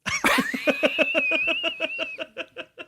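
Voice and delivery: long high burst of laughter; laughing. Real, not acted. Host laughs at his own accent. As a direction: crack up at himself. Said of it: "You like my Irish accent there?".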